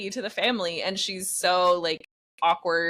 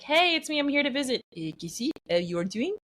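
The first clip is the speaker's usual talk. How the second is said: put-on French accent; 7 semitones higher than usual. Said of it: "I got really good at imitating him".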